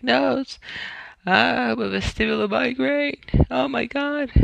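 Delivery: high-pitched mock crying wail; imitated crying. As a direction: act out a tearful call to mom. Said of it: "calling my mom crying".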